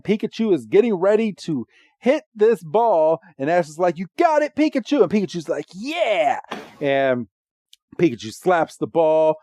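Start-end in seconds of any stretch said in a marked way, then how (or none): 4.0-5.0 high-pitched rallying cheer; mock-heroic encouragement; Ash cheering. Voicing Ash cheering on Pikachu. 5.7-6.4 high, drawn-out happy yeah; higher-pitched mock cheer; Pikachu cheering. Voicing Pikachu's eager reply.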